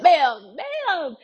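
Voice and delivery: overwhelmed, as if the insight just hit you; stunned ma'am. Real, not acted.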